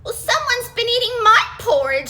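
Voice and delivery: high, loud, shocked exclamation; exclaimed. Acted. Mommy Bear exclaiming at her eaten porridge. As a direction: cry it out in dismay. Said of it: "she exclaimed".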